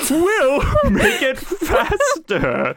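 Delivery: high, slow, breaking into a laugh; villain motto with laughter; hammed villain. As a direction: ham it up and crack up. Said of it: "the second one goes".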